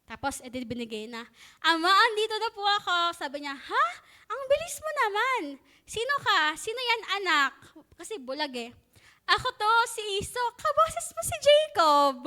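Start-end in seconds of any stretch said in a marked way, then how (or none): 1.6-3.2 son calling father. Retold or imitated. Jacob addresses blind father. 3.5-7.5 put-on old father puzzled. Blind father questions son. 9.3-10.5 lying son. Retold or imitated. Jacob pretends to be Esau. 10.6-12.2 high, slow, suspicious father voice; suspicious old father. Retold or imitated.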